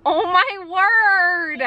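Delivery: slow, high, drawn-out "oh my word"; amazed. Real, not acted. Toddler stuffs mouth with snacks.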